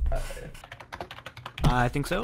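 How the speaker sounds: normal talking voice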